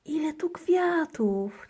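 high, slow and full of wonder; high delighted little-girl voice. A narrator's reading. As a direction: gasp at all the flowers. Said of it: "cieszy się dziewczynka".

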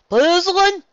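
"Puzzling" said high and loud in a puzzled twang; high puzzled country voice. Acted. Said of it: "His death wasn't disturbing as it much puzzling".

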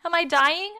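high, loud, rising panicked question; blubbering. A narrator's reading. Boy bleeding on the picnic table, blubbering. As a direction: ask it high and frantic. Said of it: "I blubbered".

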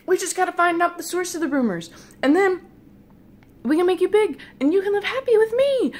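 loud, high, breathless-with-hope pitch; eager persuasion. Retold or imitated. Dreamer promising the lady gnome a life together, loud and eager. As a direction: sell the plan at full excitement.

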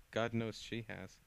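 ordinary delivery, nothing marked

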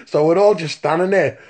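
ordinary talk, nothing marked

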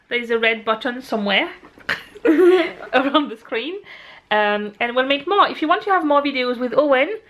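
normal talking voice

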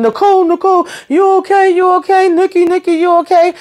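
high, anxious call to a pretend-sleeper; worried calling out. Recapper voices Terry checking on Nicole.

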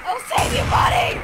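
heroic shout. Real, not acted. Rushing in to save teammate.

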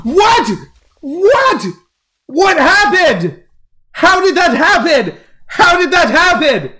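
high, loud wailing questions; shocked disbelief. Real, not acted. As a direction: shriek in stunned disbelief. Died suddenly, disbelieving.

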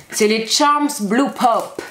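Charms Blue Pop in a slow, put-on English accent. Shown as a demonstration. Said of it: "J'ai un bel accent anglais". (mère qui prononce avec l'accent anglais)

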